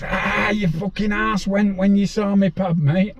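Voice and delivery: mocking shout. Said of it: "Aspinall shouts".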